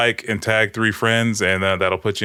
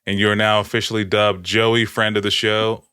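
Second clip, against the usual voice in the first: normal talking voice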